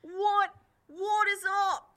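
high-pitched wounded cursing; acted pain. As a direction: yelp and curse after a blow to the nose. Jailer hit in the nose.

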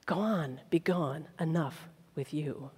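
soft, weary be gone; hushed dismissal. Retold or imitated. The king sending the slave away, quiet and weary. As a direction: brush him off quietly, almost under breath.

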